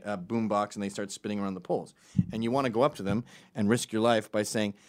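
normal talking voice

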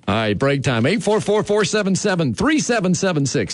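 high, brisk host sign-off; radio break announcement. Real, not acted. Host sending listeners to a break, radio announcer delivery. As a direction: rattle off the station numbers into the break.

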